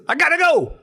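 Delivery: high-pitched mock urgency; frantic exit line. Man rushing to his truck, frantic. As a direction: imitate a man tearing off.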